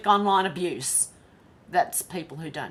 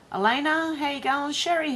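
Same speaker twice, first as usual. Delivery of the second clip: higher, sing-song hello; a cheerful hello, pitched up; bright greeting; 8 semitones higher, 3 dB louder than usual. Real, not acted. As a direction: call out to a viewer. Greeting chat viewers.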